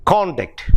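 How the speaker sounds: CON-duct, strong start and falling end; first-syllable stress on Conduct. Shown as a demonstration. Teacher repeating the noun example, stressed CON-duct. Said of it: "which is a noun or an adjective".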